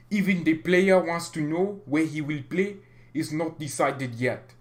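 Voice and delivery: put-on French accent for a quote. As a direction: read it out with a mock French accent. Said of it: "I'm gonna do a French accent".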